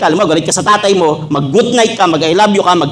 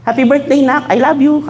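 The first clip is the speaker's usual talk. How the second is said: tender mother; 9 semitones higher than usual. Retold or imitated. Mother greets son late.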